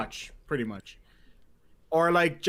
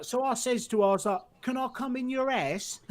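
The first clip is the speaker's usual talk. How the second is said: high-pitched, broad Cockney storyteller; Mickey Flanagan; 9 semitones higher than usual. Retold or imitated. Retelling a comedian's joke, in a broad Cockney accent. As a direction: tell it as a Cockney stand-up comic, pitched up. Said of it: "has the best Cockney accent".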